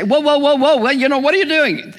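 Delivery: a startled diner stopping the waiter mid-pour; mock alarm. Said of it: "rather than say".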